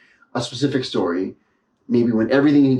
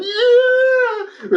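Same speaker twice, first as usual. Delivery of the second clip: triumphant yell; 3 dB louder than usual. Retold or imitated. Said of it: "I was like".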